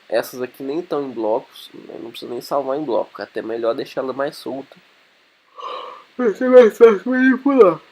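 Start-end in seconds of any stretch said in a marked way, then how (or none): 6.2-7.8 yawning through the words; stretched, high yawn mid-sentence. Real, not acted. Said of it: "tô morrendo de sono".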